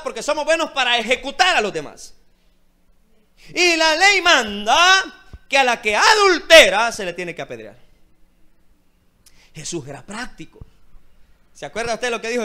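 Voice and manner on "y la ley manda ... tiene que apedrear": slow, much louder, higher, stretching ley; loud mocking accuser. Shown as a demonstration. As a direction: proclama "la ley manda" despacio y a gritos, como acusador. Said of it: "repeats louder, slower". (acusador burlón que grita "Y la ley manda" lento y fuerte)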